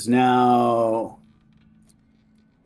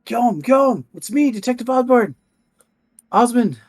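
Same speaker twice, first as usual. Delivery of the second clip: higher, louder anxious call; detective calling out; 13 semitones higher, 5 dB louder than usual. Acted. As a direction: call his name to calm him. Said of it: "he's like".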